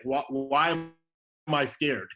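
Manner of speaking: ordinary talk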